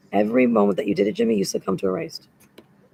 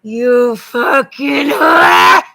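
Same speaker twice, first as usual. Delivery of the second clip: screamed, slow and much louder, dragged out; furious shout; 3 semitones higher, 13 dB louder, much slower than usual. Real, not acted. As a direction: hurl it at him with full rage. Furious outburst at an absent man.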